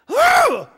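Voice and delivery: exasperated whew. Real, not acted. Exasperated at watchdog letter.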